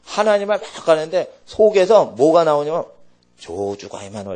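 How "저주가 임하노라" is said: low, hushed voice of doom; retold prophetic curse, dropped low; solemn curse pronouncement. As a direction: intone it like an oracle, low and soft. Same prophecy retold again.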